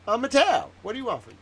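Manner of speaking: high, loud proclamation of self; shouted high boast; loud proud declaration. Real, not acted.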